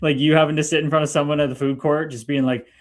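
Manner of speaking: ordinary delivery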